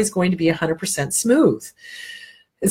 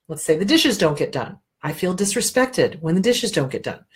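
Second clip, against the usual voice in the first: normal talking voice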